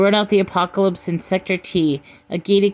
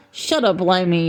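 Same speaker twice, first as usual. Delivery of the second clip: usual speaking voice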